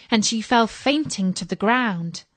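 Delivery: plain reading voice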